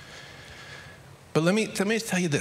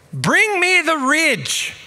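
much higher, louder, slow call up from a roof; shouted order; 13 semitones higher, 8 dB louder, much slower than usual. Retold or imitated. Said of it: "he shouted out to me".

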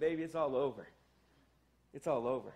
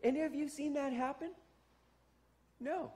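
sarcastic; 7 semitones higher, 4 dB quieter than usual. Real, not acted. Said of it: "let me be a little bit sarcastic".

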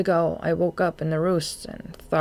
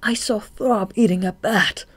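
put-on higher, agitated young bat; horrified report; 6 semitones higher, 4 dB louder than usual. A narrator's reading. Shade tells Marina what he saw, horrified. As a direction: blurt out the horror, pitched up. Reporting horror to his friend.

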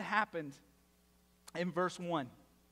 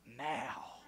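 low, soft, drawn-out command; imitating parents' ominous order; 6 semitones lower, 6 dB quieter than usual. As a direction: mimic a parent's hushed menace. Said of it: "That's how they said it too".

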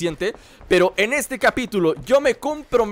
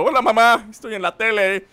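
lightly voiced eager bystander waving at the camera; a hint of someone hamming for the news camera; mock bystander on TV; 3 dB louder than usual. Bystander behind a news camera greeting mom, lightly voiced. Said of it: "así como diciendo".

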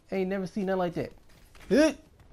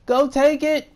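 loud, higher, slowed shouting; hype shouting; 11 semitones higher, 10 dB louder than usual. Real, not acted. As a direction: cheer the teammate on at full voice. Teammate sacrifices to win.

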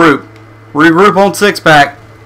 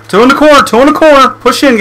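urgent yell; 9 semitones higher than usual. Real, not acted. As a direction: yell the warning fast. Enemies spotted, warning team.

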